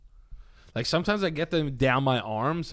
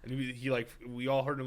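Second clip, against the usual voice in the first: usual speaking voice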